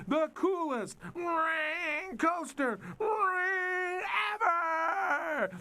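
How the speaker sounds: high, booming ringmaster pitch with guitar-noise fills; showman announcer. Acted. Phineas introducing the coaster to the crowd, grand showman voice. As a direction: sell it like a circus barker.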